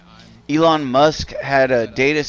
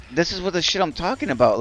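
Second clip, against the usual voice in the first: normal talking voice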